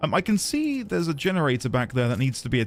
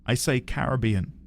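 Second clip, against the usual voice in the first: usual speaking voice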